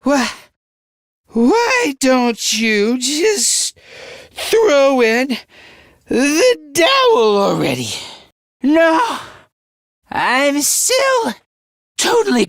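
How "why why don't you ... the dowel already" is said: slow, effortful drawn-out challenge; strained, worn out. Acted. Pushing a rival to give up, slow strained delivery. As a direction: drag each phrase out under strain.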